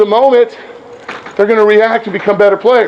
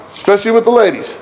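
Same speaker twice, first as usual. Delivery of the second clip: ordinary talk, nothing marked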